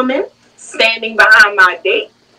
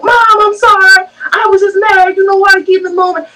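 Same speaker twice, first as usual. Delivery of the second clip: mock apologetic daughter; 9 semitones higher than usual. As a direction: plead sweetly like a scolded child. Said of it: "she's like".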